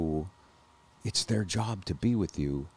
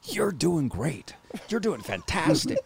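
higher, slow, drawn-out encouragement; cheering coach; 9 semitones higher, slower than usual. Retold or imitated. Doctor encouraging the choking patient, slow high cheering. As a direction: coax him along like a cheerleader. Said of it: "and he's going".

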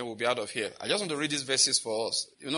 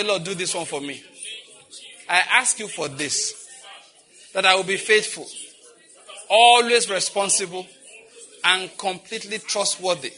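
rising, louder on always responsible; fervent prayer; 3 semitones higher, 4 dB louder than usual. Real, not acted. Pastor leading congregation in prayer, fervent. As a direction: plead it with growing force.

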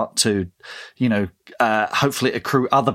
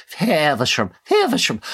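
"Feversham" said posh, the second take much higher and hammed-up; ever posher English; 4 semitones higher than usual. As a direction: make each "Feversham" posher than the last, like a train announcement. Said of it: "it just becomes posher and posher every time we say it".

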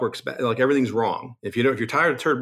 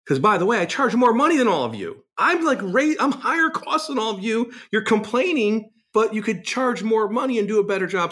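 higher, rising indignant rant at the board; fired-up higher-pitched comeback to the association; heated retort; 11 semitones higher than usual. Retold or imitated. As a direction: push back hard, pitch climbing with indignation.

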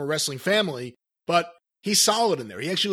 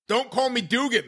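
annoyed correction; 6 semitones higher than usual. Retold or imitated. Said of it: "did not like being called Dugan".

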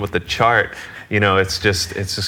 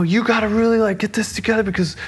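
high, strained mock rant; imitating rising anger; 13 semitones higher than usual. Music director scolding his drummer, mock worked-up. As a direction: act out a boss working himself up, pitched way up. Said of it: "he's just getting louder and louder".

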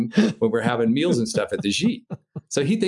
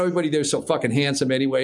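normal talking voice

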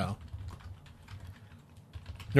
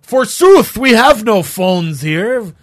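high, loud, slow mock-medieval proclamation; hammy stage actor; 4 semitones higher, 4 dB louder, slower than usual. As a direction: ham it up like a fake knight. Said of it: "like South Park? We're like".

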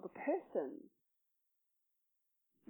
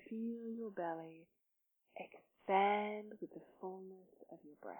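guided meditation; 3 semitones lower, 8 dB quieter than usual. Real, not acted. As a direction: gently guide them into breath and body, softly. Leads a breathing meditation.

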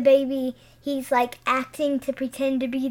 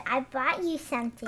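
cute voice; 7 dB quieter than usual. Retold or imitated. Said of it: "the baby said".

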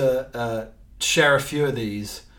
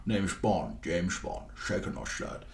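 Bond line; 6 semitones lower, 8 dB quieter than usual. Retold or imitated. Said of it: "most famous one was obviously James Bond".

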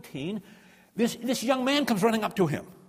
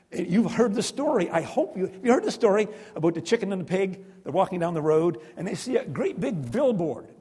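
usual speaking voice

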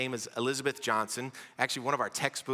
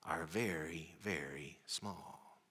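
small quiet voice; 7 semitones lower, 11 dB quieter, much slower than usual. Retold or imitated. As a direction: drop to a hush on very small. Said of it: "pulled her arms in like this".

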